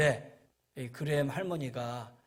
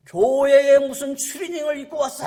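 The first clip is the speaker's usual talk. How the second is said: much louder, much higher angry rebuke; scolding shout; 11 semitones higher, 14 dB louder than usual. Retold or imitated. Church elder scolds the boy in a tracksuit, loud angry shout. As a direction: bark at the boy for wearing a tracksuit. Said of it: "하면서 야단을 치더라고요".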